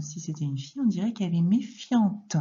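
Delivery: ordinary delivery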